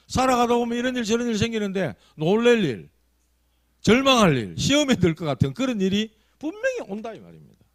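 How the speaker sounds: loud, high preaching climax; fervent preaching. Acted. Pastor warning the congregation, preaching loud and high. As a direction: drive it home to the congregation.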